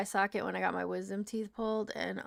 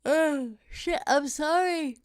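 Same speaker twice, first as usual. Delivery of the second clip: usual speaking voice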